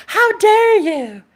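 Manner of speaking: high, slow, offended scoff; mock outrage. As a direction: sneer it like a scandalised man. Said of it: "And you're like".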